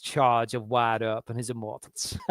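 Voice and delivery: slow, softened film-quote delivery; imitated gunslinger line. Co-host savours a cheesy line for John, slow hushed imitation. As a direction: draw it out quietly, lightly in character. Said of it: "And he says".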